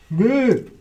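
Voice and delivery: loud high disgusted bleh; disgusted by taste. Real, not acted. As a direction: gag at a nasty chip flavor. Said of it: "not getting that taste out of my mouth".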